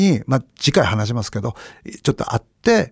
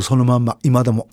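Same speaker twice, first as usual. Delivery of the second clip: normal talking voice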